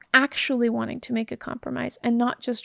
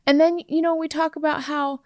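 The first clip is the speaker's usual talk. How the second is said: ordinary delivery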